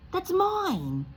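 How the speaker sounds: put-on high, loud boy's protest; a kid claiming what's his; indignant boy. Harry to Uncle Vernon, a high indignant boy's protest.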